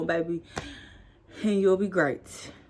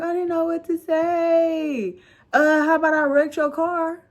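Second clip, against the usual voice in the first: whiny; 9 semitones higher, 4 dB louder than usual. Retold or imitated. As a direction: sulk out the excuse. Whiny exchange with mom.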